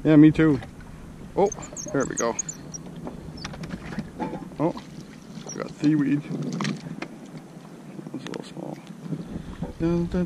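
normal talking voice

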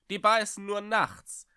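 loud, high teasing call; loud call with a hint of mockery. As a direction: call it up the stairs with a smirk. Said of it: "rief der Schrecksmeister".

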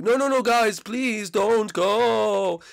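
high, slow whining plea; mock pleading. Blimpy begging Luigi not to leave, high and slow. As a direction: drag out the begging like a sulky ghost.

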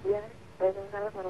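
faint caller on phone line; very quiet, far from mic; barely audible. Real, not acted. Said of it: "suaranya agak terlalu kecil di sini".

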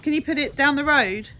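loud, high anxious plea; desperate to get rid of it; panicked. Real, not acted. Afraid of the spider.

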